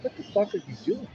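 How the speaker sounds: high-pitched, incredulous retort; jolted awake and demanding an answer, retold; startled, pitch leaping without shouting; startled protest. Woken by being hit.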